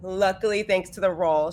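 normal talking voice

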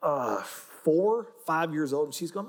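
ordinary delivery

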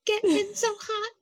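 squeaky high tipsy voice; high drunk girl voice. Retold or imitated. Drunk Elle mimicked high.

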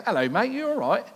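high, loud put-on Essex accent; hammy London bloke saying hello; mock London greeting. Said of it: "as Andrew from Belfast".

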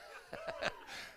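very high, soft laugh; a quick burst of giggles. Real, not acted. Laughing at own story.

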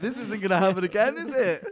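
loud, high playful challenge through laughter; mock defiance. Teenager warning mum off, loud mock defiance.

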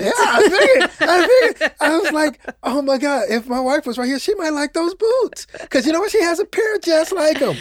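high and loud, bubbling with laughs; gleefully piling on; excited laughing agreement. Real, not acted. Excited agreement, wife's boots.

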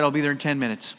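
quiet menace, utterly unbothered; calm son on the phone, softly assured; calm reply. Retold or imitated. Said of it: "calmly responded".

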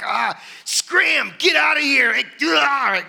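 put-on loud, high shout to shoo kids away. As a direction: bark at the kids to scram. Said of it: "shaking them off".

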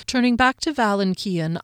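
ordinary delivery

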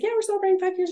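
high-pitched happy announcement, lightly acted; chirpy retelling. Retold chat with restaurant owner.

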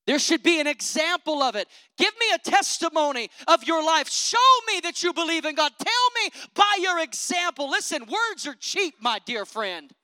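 loud preacher's challenge; preaching at full force. Acted. Preacher challenging hypocrites in church, loud. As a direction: demand it from the pulpit.

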